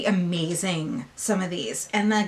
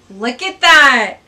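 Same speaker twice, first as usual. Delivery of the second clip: excited; 13 dB louder, slower than usual. Real, not acted. Showing swatch.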